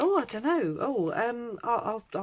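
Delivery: high, flustered and dithering; flustered nurse. Retold or imitated. Woman mimicking the flustered nurse, high and dithering. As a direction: stammer like a caught-out nurse.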